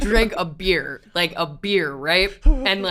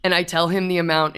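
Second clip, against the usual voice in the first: normal talking voice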